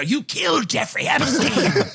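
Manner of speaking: high, slow accusing rant in a put-on voice; a hammed-up crank flinging accusations; mock conspiracy theorist. Imagined guest accusing the hosts, mock conspiracy rant. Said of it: "just start throwing out conspiracies".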